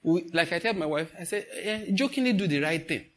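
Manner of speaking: ordinary talk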